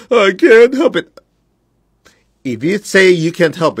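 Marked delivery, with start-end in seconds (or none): 0.1-1.2 much higher, louder, slow mock whine; whiny helpless excuse. Teacher mocking the excuse he rejects, high whine. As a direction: wail the excuse like a helpless child. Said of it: "So there's no such thing as".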